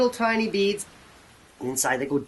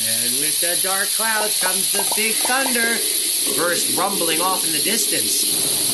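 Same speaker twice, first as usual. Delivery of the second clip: slow storyteller narration; slower than usual. A narrator's reading. Narrating the storm for kids.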